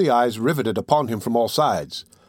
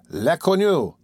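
jeering students; 5 semitones higher, slower than usual. A narrator's reading. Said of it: "said some".

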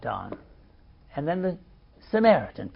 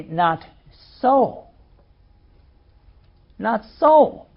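louder and higher on the repeated "Not so"; firm denial; 3 semitones higher, 5 dB louder, slower than usual. Retold or imitated. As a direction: plant your feet and say "Not so" twice, rising. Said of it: "And my response would be".

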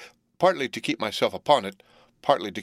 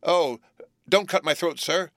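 put-on high, louder frightened boy's plea; pleading in terror; 8 semitones higher, 3 dB louder than usual. A narrator's reading. Boy begs the convict for mercy, terrified pleading. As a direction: beg for your life, voice jumping up. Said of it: "I pleaded in terror".

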